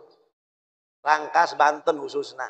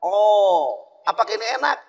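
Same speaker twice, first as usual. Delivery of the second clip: mock Dutchman; 5 semitones higher than usual. Dutchman asking about coconut.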